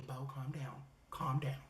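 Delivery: very soft, low aside; calming aside. Acted. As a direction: hush them down in a low, tiny voice. Inner voice soothing the storyteller.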